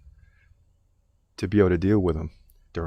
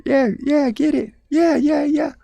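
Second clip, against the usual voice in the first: high distracted yes; 4 dB louder, slower than usual. Distracted man waves off wife.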